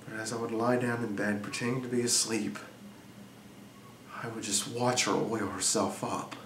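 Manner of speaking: hushed, low, brooding delivery with long pauses; brooding confession. Acted. Jealous husband confiding in Frankie about his wife, hushed and brooding. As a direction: confide it softly and low, letting pauses hang. Brooding opening of dramatic monologue.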